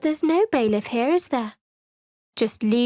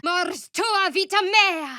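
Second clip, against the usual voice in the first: higher, slow, forceful casting chant; spell incantation; 8 semitones higher, slower than usual. Acted. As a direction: call out the spell like a battle cry.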